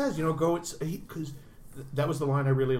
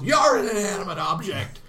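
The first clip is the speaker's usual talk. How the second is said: a hammed-up yelled retort, higher and louder; mock yell; 6 semitones higher, 6 dB louder than usual. Harry yelling back at his wife in a hammed-up shout. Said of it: "he just yells".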